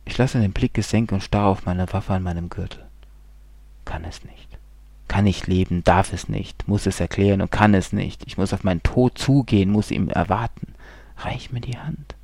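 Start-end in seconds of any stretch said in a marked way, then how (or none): 3.9-4.5 unvoiced, lower whisper; broken whispered refusal. A narrator's reading. 11.2-12.1 higher, softer whisper; whispered voice of death; death's whisper. A narrator's reading. Death beckoning.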